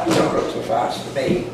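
ordinary delivery, nothing marked

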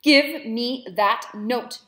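loud, very slow menacing demand; furious demand. Acted. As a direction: drag each word out as a threat. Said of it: "Madam demanded".

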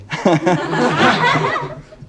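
loud high-pitched burst of laughter. Real, not acted. Laughs at own sexy remark.

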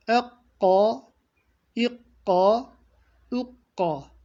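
Ekka with a deep throat k held double; Ekka, deep qaf; deep doubled q. Shown as a demonstration. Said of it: "doubled qaf drill".

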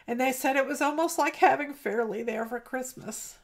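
ordinary talk, nothing marked

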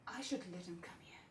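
soft, whispery and quick; hushed private musing; scheming murmur. Acted. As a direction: murmur the idea to yourself. Monologue, musing to herself about revenge.

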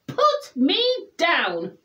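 high, slow, indignant order; shouted. A narrator's reading. Rabbit picked up by Douglas, mildly shouted demand. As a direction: punch out each word in protest. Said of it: "shouted the rabbit".

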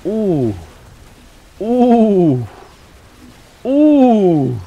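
high, loud drawn-out ooh; loud falling whoop; excited ooh. Real, not acted. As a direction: whoop a long ooh that slides down. Excited by the game.

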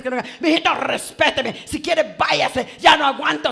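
hammed-up indignant comeback; mock marital quarrel. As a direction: act out an indignant wife answering back, building to a shout. Acted wife snapping back at husband.

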